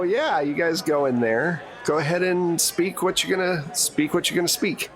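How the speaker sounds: ordinary talk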